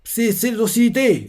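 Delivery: retold yell to the seraphim; mock shout. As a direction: shout at them to stop. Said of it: "Il a crié ça".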